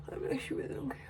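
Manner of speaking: soft voiceless shrug of a line; whisper. Real, not acted. Shrugging off her hair worries, whispered. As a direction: toss it off in a whisper.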